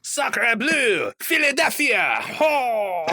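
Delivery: high, loud hammed-up French accent. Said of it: "taught it to speak French".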